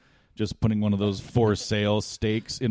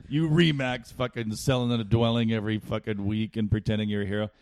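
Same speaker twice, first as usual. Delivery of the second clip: ordinary talk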